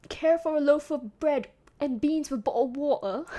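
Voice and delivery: hammed-up British accent, glottal Ts, on the edge of laughter; mock British. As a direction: put on a loud comic British accent. Comic mock British line.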